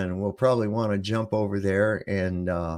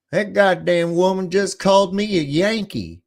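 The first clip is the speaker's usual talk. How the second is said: higher southern drawl, put-on and offended; imitating southern drawl; 8 semitones higher, 3 dB louder than usual. Arkansas friend complains to his buddy in a southern drawl. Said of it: "heavy-duty southern drawl".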